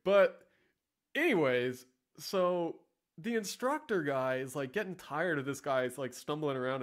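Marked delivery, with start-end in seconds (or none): none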